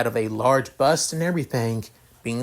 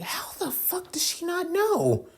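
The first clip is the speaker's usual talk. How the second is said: very high, softer squeak of disbelief; baffled, squeezed up into a high falsetto; confused; 5 dB quieter than usual. A narrator's reading. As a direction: ask it in incredulous high-pitched bafflement. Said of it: "him basically confused".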